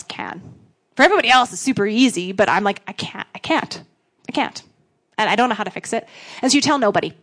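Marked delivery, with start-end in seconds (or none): 2.9-4.6 helpless near-whisper. Retold or imitated. Voicing own private helplessness.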